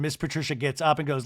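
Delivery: usual speaking voice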